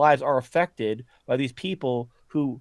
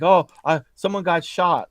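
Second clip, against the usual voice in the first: higher, louder mock panic; a rioter phoning in, hammed-up alarm; mock panic call; 5 semitones higher, 6 dB louder than usual. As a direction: rioter mocked calling the emergency line.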